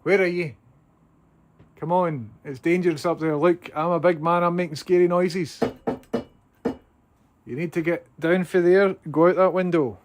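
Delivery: loud, high urging call; coaxing a wild bird. Real, not acted.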